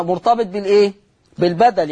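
usual speaking voice

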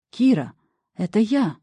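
usual speaking voice

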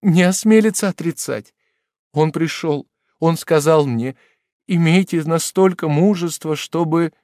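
high, loud pleading through tears; tearful exclamation. Acted. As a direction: cry out to him, begging him to listen. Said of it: "заливаясь слезами, воскликнула".

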